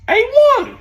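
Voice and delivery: loud excited station call; hype shout. Real, not acted. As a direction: show name yelled high and loud. Show name called out.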